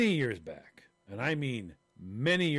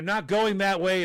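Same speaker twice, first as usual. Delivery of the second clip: retold shout; 6 semitones higher, 8 dB louder, slower than usual. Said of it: "Christine, I start shopping".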